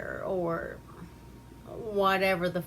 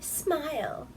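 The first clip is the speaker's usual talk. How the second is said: lightly smarmy guy; 9 semitones higher than usual. Retold or imitated. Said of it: "And he's like".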